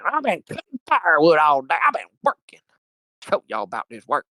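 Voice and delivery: high, hammed-up boast about hard work; mocking braggart. Mocking locals who brag about working, hammed-up high voice. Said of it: "walk around saying".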